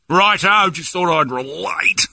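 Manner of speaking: high, drawn-out Aussie caricature; hammed-up faux Australian; mock Australian accent. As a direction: defend yourself in a fake Australian accent. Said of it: "on an Australian level".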